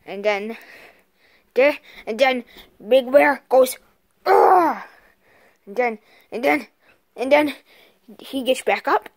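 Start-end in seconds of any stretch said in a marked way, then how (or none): 4.2-4.8 pained grunt. Pretend. Toy bear takes a punch.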